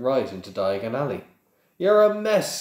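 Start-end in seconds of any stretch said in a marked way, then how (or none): 1.8-2.6 loud, high-pitched gruff scolding. A narrator's reading. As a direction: scold him roughly, much louder. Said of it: "said Hagrid gruffly".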